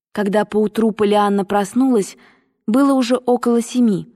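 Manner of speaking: ordinary delivery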